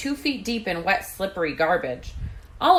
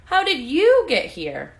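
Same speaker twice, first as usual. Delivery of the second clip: amazed; 6 semitones higher, 6 dB louder than usual. A narrator's reading. Surprised mouse meets rescuer.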